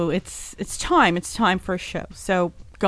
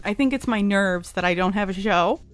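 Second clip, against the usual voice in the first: ordinary talk, nothing marked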